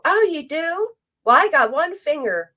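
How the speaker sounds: mock the chat's sob story; mock whiny chatter. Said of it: "They're in there like".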